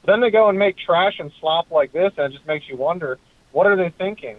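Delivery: normal talking voice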